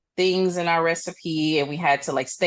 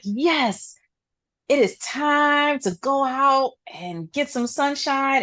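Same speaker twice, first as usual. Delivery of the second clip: higher-pitched, celebratory re-enactment; elated relief; 8 semitones higher, slower than usual. Retold or imitated. Acting out leaving work into sunshine, elated and higher-pitched. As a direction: revel in being let out for the day.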